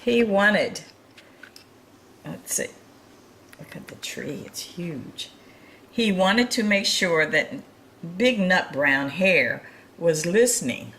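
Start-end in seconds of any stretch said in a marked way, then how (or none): 2.3-5.3 whispered aside. Real, not acted. Pointing out picture softly. 5.9-10.9 lively storybook reading voice; reading to children. A narrator's reading. Reading picture book to children.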